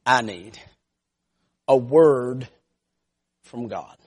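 low, soft and spaced out; hushed preaching. Real, not acted. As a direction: let each phrase sink in quietly. Preacher drops to hushed emphasis.

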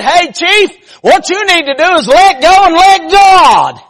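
high, loud call to a guard; hollering prisoner. Retold or imitated. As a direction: holler it out like a preacher. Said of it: "hollered out and called my name".

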